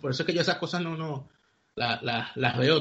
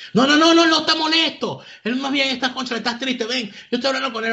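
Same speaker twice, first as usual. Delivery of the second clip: imita una protesta aguda y atropellada; rebatiendo con insistencia; 9 semitones higher, 4 dB louder than usual. Retold or imitated. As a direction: corta al otro con noes muy agudos, luego baja un poco. (rebatiendo con insistencia, en voz muy aguda)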